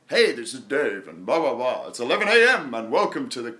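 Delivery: Dave's voiceover voice. Retold or imitated. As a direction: ham up a show-host opening. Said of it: "you know, in your voice".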